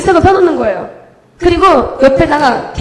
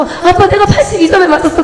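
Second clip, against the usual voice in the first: high, thrilled report to a parent, retold; overwhelmed with joy; 6 semitones higher, 4 dB louder than usual. Child proudly telling dad a test score, overjoyed. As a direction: gush it a little, voice lifted high. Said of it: "제가 너무 감격에".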